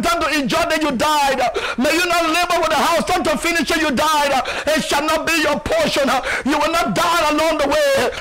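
rising sermon proclamation, hammering each line; fiery preaching. Acted. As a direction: declare it with force, like a pulpit preacher. Preaching declarations.

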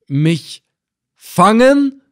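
disbelief, then outrage; incredulous cry. A narrator's reading. Reis Effendina hearing of the trap, incredulous cry. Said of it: "rief er aus".

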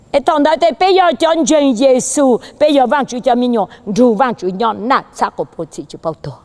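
high preacher voice easing down; fervent preaching. Real, not acted. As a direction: start high and ringing, then settle toward the end.

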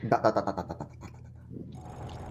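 low, fading, slow robot drone. Pretend. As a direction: let it die out to a whisper. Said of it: "Many dots".

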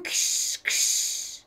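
breathy scraping ksh sound; unvoiced hiss noise. Boy imitating Papa's shop, breathy scraping hiss. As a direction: hiss it like a file on metal.

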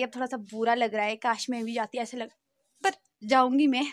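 normal talking voice

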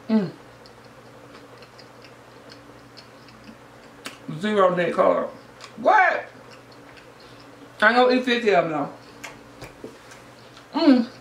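chewing while talking; eating a wrap; mouth full. Real, not acted. Seen 3: Bit the wrap at -7; lips keep chewing through the labelled words.

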